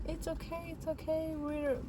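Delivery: soothing doctor. Retold or imitated. Doctor calming a crying patient, soft and soothing. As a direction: hush her gently like a kind doctor. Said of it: "and she was so nice".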